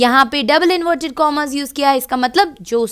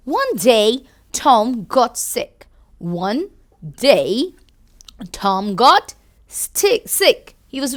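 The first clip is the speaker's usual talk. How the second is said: storybook reading; much slower than usual. A narrator's reading. Reads storybook to children.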